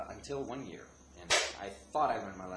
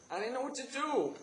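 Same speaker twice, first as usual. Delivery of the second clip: very high, panicky fake wail; put-on desperate, high voice; faked distress; 5 dB louder than usual. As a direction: plead helplessly at a high pitch. Acted fake distress on phone.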